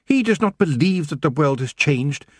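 high, aggrieved elf voice; indignant little queen; angry elf queen. Acted. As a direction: complain bitterly, pitched high.

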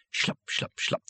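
hushed, voiceless footstep sounds; soft, spaced-out squelching steps; creeping footstep imitation. A narrator's reading. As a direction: whisper each step as an eerie sound effect. Imitates following footsteps, slowly.

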